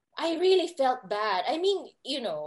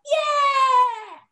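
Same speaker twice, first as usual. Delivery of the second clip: high, loud triumphant "yeah"; excited cheer; 10 semitones higher, 7 dB louder than usual. Retold or imitated. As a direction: cheer out loud as if lifted before a crowd. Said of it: "then I was like".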